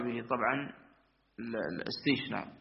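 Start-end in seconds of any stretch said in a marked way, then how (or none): none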